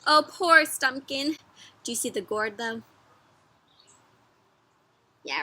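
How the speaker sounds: usual speaking voice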